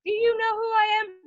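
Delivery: mock the offended RD; high, outraged imitation; indignant shriek. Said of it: "She was just like".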